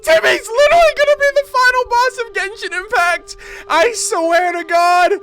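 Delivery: high, loud freakout; panicked laughing shout about the final boss; yelling in disbelief. Real, not acted. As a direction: shout it like you can't believe it.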